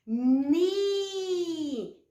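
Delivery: Ni held long and clear; syllable demo. Shown as a demonstration. Teacher models Ni for the class. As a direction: sound out Ni slowly. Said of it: "Vamos ver?".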